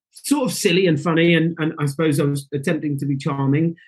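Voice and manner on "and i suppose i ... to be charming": ordinary talk, nothing marked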